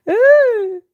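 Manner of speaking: loud, very high delighted "ooh"; high excited squeal. Real, not acted. Squealing with delight after laughing about her liner.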